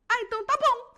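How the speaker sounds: high, loud, thrilled acceptance; happy surprise at the upgrade; delighted. Retold or imitated. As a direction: squeal it out like you can't believe your luck. Delighted at free business class.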